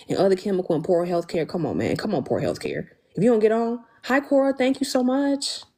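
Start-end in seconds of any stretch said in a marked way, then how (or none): none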